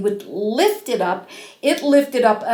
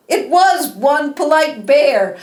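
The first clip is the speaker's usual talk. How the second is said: ordinary delivery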